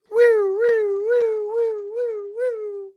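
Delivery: very high, loud wailing woo-woo; siren imitation. The ambulance siren as George wakes, very high.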